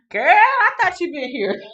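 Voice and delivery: high, loud delighted welcome; excited greeting. Real, not acted. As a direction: squeal with joy at a friend arriving. Greeting a latecomer.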